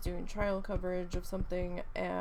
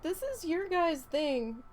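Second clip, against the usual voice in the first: slow, higher and louder, mock irritated; annoyed; 9 semitones higher, 5 dB louder, slower than usual. Husband refusing to help dump tanks, mock annoyed. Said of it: "He was mad".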